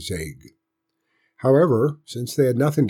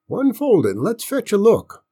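high, louder grandpa demand; folksy old man's demand; 11 semitones higher, 5 dB louder than usual. A narrator's reading. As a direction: eagerly order the boy to show it. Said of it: "he demanded".

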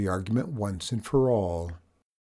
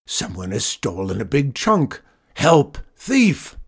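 roaring; 4 dB louder than usual. A narrator's reading. Farmer raises the alarm over a thief, roaring. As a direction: build from accusation to a yelled alarm. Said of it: "roared Zeke".

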